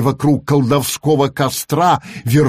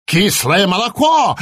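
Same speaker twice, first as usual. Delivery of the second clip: raven crying out in mock horror, higher; lightly voiced raven recoiling in horror; horrified croak; 6 semitones higher than usual. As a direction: squawk it out aghast. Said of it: "в ужасе каркнул Абрахас".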